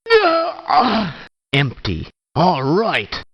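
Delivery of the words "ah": sharp high yelp of pain; a short, high, hurt yell; pained cry. Acted. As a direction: cry out as if shot.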